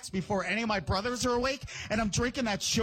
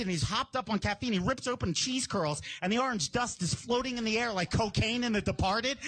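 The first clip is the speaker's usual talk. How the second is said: usual speaking voice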